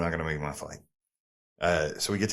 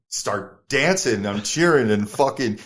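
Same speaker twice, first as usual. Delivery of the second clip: ordinary delivery, nothing marked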